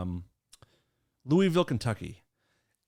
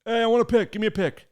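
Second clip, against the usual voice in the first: louder, higher call from the back; 9 semitones higher, 6 dB louder, faster than usual. Retold or imitated. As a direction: shout for a pick over the crowd. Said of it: "one guy in the back being like".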